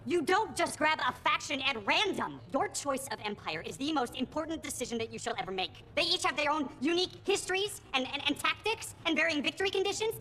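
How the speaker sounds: high, insistent geeky protest; indignant fan explaining what matters; scandalised lecture. Acted. Scandalised by casual faction choice.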